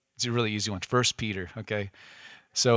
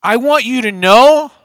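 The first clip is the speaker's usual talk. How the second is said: defiant Peter; 8 semitones higher, 10 dB louder than usual. Acted. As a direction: stand up to him, voice raised, every word insisted on. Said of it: "he stands up defiantly to Jesus".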